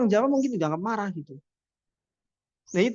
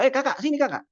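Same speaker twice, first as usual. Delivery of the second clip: higher, harsh call that sounds angry; easterner's gruff call; 7 semitones higher, faster than usual. Retold or imitated. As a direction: bark the summons like you're cross. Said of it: "Kan kayak marah itu". (nada seperti marah)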